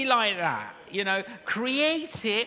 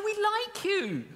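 mock sweet insistence; 12 semitones higher, slower than usual. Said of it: "They go".

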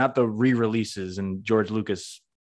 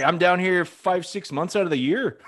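raised pitch, imitated Canadian accent; mock Canadian, pitched up; 8 semitones higher than usual. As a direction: put on a thick Canadian accent. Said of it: "mean to do a Canadian accent".

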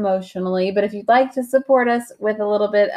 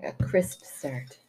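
whisper; 4 semitones lower, 9 dB quieter, much slower than usual. Real, not acted. Whispered aside.

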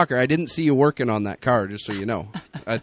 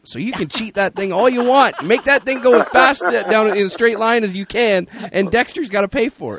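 excited teasing; 9 semitones higher, 5 dB louder than usual. Real, not acted. Excited teasing about cheating.

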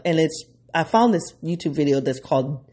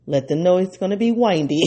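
normal talking voice

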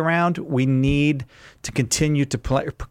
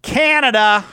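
high, loud, ringing announcement; excited call-out; 10 semitones higher, 6 dB louder, slower than usual. Real, not acted. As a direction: call out the name with gusto. Host announcing a caller's place.

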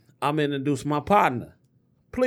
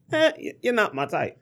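mock Trump dismissal; 8 semitones higher than usual. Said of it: "and you say".

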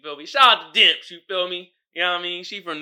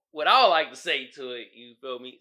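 ordinary delivery

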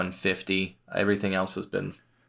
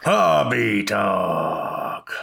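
slow, drawn-out echo of the call; announcer echo; 3 dB louder, much slower than usual. Acted. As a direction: echo the catchphrase like a co-host. Co-host echoes catchphrase.

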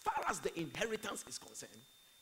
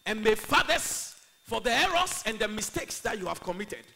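very loud, raised preacher's blessing; forceful prayer; 6 semitones higher, 14 dB louder than usual. Real, not acted. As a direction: proclaim the blessing over the congregation. Prays over congregation at full force.

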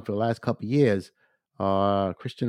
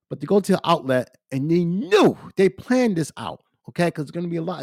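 raised, higher voice, venting outrage; heated rant; 7 semitones higher, 3 dB louder than usual. Real, not acted. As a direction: let the anger spill out loud and high. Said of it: "I'm sorry to rant and rave like this".

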